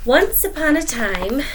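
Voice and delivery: bright, loud storybook opening; story opening. A narrator's reading. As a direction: launch the tale with big energy.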